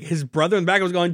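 normal talking voice

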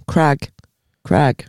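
"Crag" with a short flat A; American pronunciation. Shown as a demonstration. Mocking how Americans say the name, "Crag" with short A. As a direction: say "Crag" to rhyme with bag. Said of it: "always say the name Craig so mad".

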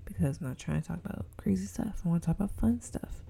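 soft, breathy confiding whisper; whispered aside. Real, not acted. Podcaster steering listeners to fun topics, whispering. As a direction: let them in on it quietly.

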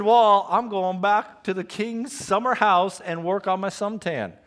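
high, loud mock complaint about going back to the palace; whiny quitter Nehemiah.

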